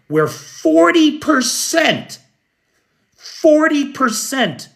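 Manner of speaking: forty percent lifted high and slow; FORTY hit high and drawn out, repeated for shock; stress on forty. Real, not acted. Commentator stressing the grim figure, slow and high.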